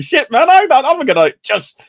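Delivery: high-pitched, half-comic panic; mock a friend about to be sick on a packed train; imitating a squashed friend. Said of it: "You're going".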